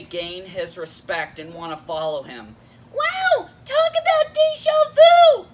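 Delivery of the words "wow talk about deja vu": very high, loud squeaky sidekick; Pikachu. Acted. Sidekick chiming in on the review, amazed squeak. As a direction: pipe up in a tiny excited creature voice. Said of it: "Sure, Pikachu".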